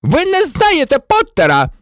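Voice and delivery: high-pitched furious protest; enraged screech. A narrator's reading. Snape insisting to the minister, screeching. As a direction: screech it, beside yourself. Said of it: "верещав Снейп".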